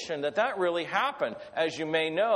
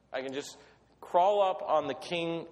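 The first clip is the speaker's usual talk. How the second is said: ordinary talk, nothing marked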